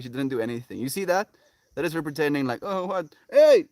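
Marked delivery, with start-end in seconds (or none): 2.6-3.7 voicing the dog.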